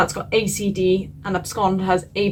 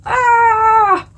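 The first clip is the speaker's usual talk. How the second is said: frustrated shriek; 13 semitones higher, 7 dB louder than usual. Real, not acted. Frustrated over choosing a word.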